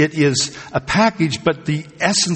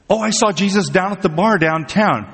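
raised pitch, eager false report; mock excited rumor; 6 semitones higher than usual. Preacher imitates somebody reporting Jesus in the desert, mock excited. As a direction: pitch it up like a gullible tipster. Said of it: "If you hear somebody saying".

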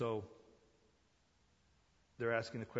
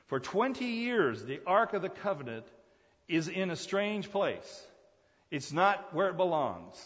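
high, stretched-out pulpit cadence; emphatic preaching; 7 semitones higher, 8 dB louder than usual. Real, not acted. Preacher laments the ark's twenty years away, emphatic preaching. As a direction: hammer each phrase home.

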